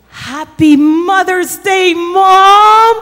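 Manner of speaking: slow, loud, rising greeting; joyful call. Acted. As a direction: call it out through the door. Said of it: "and she said".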